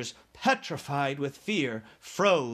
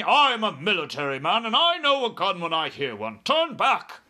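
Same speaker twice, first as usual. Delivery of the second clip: high, loud blustering officer; indignant military shout; 5 semitones higher, 6 dB louder than usual. A narrator's reading. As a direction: bellow it, building to a sharp order. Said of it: "shouted the head of the army".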